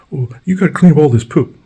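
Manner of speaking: high, loud demanding neighbor voice; high loud mock complaint; mock angry neighbor. Neighbor at door, acted.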